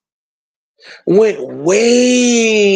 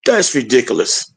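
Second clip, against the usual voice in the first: dismissive politician; 8 semitones lower than usual. Retold or imitated. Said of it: "And he was".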